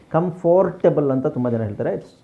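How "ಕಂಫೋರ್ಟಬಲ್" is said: ಕಂಫರ್ಟಬಲ್ with a full "for" syllable; wrong pronunciation. Shown as a demonstration. Teacher quoting what many people say, wrong pronunciation. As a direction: say ಕಂಫರ್ಟಬಲ್ as kam-FOR-ta-bal. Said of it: "that's not correct pronunciation".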